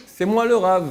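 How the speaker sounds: loud, high boast it is me; proud claim to be the rabbi; proud declaration. Shown as a demonstration. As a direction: announce yourself proudly. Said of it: "on ne peut pas dire".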